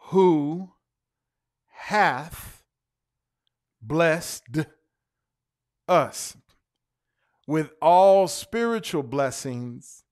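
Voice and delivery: slow, spaced reading with long pauses; measured pulpit reading; solemn scripture reading. Acted. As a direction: read the verse word by word, solemnly.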